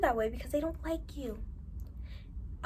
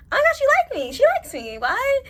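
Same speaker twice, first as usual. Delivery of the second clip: disbelief; 9 dB louder than usual. Retold or imitated. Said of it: "There's been a lot of people where I'm like".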